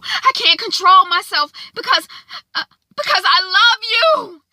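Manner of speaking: high, tearful, loud apology; crying plea. Acted. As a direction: sob it out as a desperate confession. Isaiah begging Zora's forgiveness.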